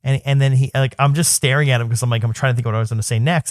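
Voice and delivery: ordinary talk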